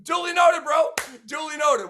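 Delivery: high, loud, mock-obliging duly noted; mock agreeable reply. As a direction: play along eagerly with a grin. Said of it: "I'm like".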